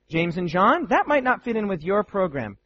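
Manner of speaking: ordinary delivery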